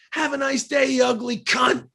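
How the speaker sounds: high shouted mock insult; retold angry yell. Musician retelling the homeowner's insult, mock angry shout. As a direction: spit it out like the furious homeowner. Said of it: "she's like".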